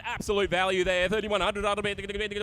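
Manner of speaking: rapid rolling auction call; auctioneer calling. Acted. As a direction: rattle off the bids fast. Live auction chant.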